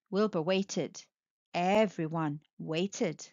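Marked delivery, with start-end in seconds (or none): none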